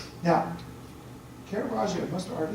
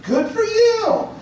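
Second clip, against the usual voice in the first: much higher, louder, slow beaming cry of approval; delighted cheer; 14 semitones higher, 9 dB louder, slower than usual. Retold or imitated. Priest answering a doubting penitent, delighted cheer. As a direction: cheer the confession like great news. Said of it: "they're shocked".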